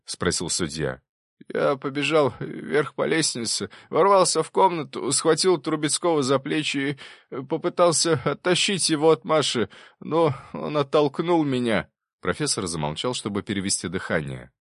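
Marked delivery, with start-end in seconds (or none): 1.5-11.9 high-pitched, urgent account of a struggle; professor confessing, agitated. Acted. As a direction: relive the rush and struggle, pitched up. (взволнованный рассказ профессора о схватке)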